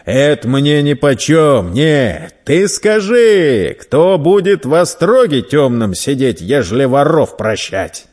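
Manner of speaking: loud, insistent browbeating; unmoved merchant. Acted. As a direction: press the boy hard for an answer. Merchant rejects the plea.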